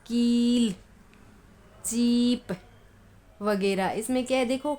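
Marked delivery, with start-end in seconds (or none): 0.0-2.5 कील, चीप with long, held 'ee'; long drawn vowel. Shown as a demonstration. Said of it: "खूब खींचकर पढ़ी जाती है". (कील, चीप की ई खूब खींचकर)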